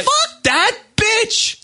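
slow, high, drawn-out curse; real furious curse; angry outburst. Real, not acted. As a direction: spit it out slowly. Angry rant at harasser.

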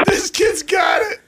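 high, slow shout; excited shout. Real, not acted. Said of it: "I don't care what you guys say".

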